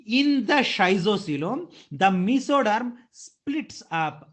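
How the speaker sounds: slow, deliberate teacher emphasis; walk them through it slowly; slow explaining. Shown as a demonstration. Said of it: "देखो".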